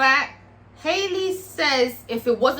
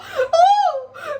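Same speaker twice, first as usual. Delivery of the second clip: a sudden high, loud squeal of delight; excited squeal; 6 dB louder than usual. Real, not acted. Vlogger squealing at a chainsaw entrance, excited. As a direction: shriek with glee at the chainsaw.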